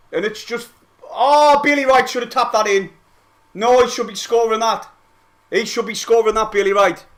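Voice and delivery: frustrated fan raging at the miss; worked-up complaining. Real, not acted. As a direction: moan loudly about the missed chance. Complaining about missed chance.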